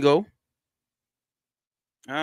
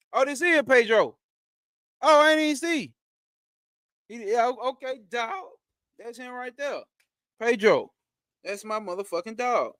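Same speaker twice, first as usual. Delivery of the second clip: higher, bursting with glee at the dog; light up, suddenly much higher; excited greeting; 7 semitones higher than usual. Real, not acted. Host spotting his dog Pedro on stream, delighted.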